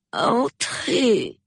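high, drawn-out sleepy call; answering from sleep. A narrator's reading. Sleeper answers a knock at the door, drowsy high call. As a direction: call out automatically, half asleep. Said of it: "du fond de son sommeil".

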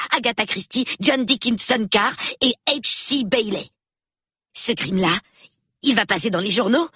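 put-on a nine-year-old thrilled to meet the police; excited little boy. A narrator's reading. Peter keeps talking eagerly. (petit garçon tout excité, voix plus aiguë)

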